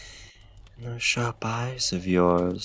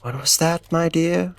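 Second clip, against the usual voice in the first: normal talking voice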